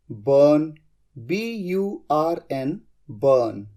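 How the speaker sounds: ordinary talk, nothing marked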